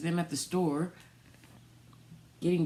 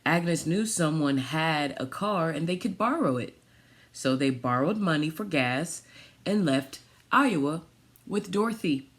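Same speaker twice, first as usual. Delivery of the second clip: storybook reading; 5 dB louder than usual. Acted. Reads picture book aloud.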